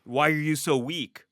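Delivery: hammed-up inner critic; self-scolding inner voice. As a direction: snap at yourself for being weak. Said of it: "I'm like".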